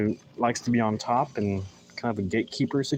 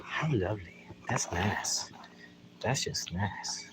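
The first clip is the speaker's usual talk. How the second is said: whispering; 7 dB quieter, slower than usual. Acted. Whispered soil appreciation.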